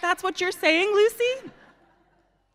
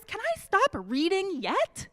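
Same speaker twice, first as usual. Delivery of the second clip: mock pleading; 9 semitones higher than usual. Reader begs to stop.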